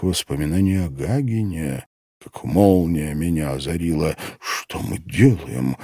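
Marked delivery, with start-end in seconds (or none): none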